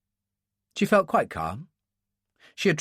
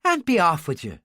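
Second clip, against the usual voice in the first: higher-pitched Irish voice; Irish accent; 4 semitones higher than usual. Acted. Woman with a gun dismisses an altar boy, Irish accent. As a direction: send a child away in an Irish accent. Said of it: "she said in an Irish accent".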